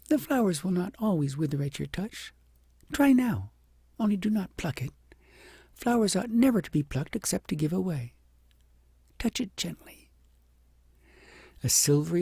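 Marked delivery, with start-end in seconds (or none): 0.1-10.1 put-on higher, encouraging child; gently coaxing the princess; child teaching princess to touch flower; kind little girl. A narrator's reading.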